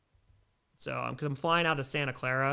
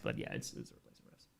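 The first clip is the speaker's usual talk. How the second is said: breathy whispered aside; hushed confession of bias; 16 dB quieter, faster than usual. Real, not acted. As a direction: drop to a whisper, half joking. Whispered aside about refs.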